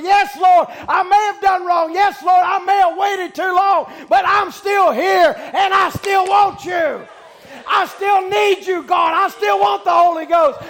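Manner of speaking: loud, high, pleading prayer; slow shouted plea, yes Lord; crying out to God. Retold or imitated. Preacher shows the congregation how to cry out, loud pleading. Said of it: "start crying out to God".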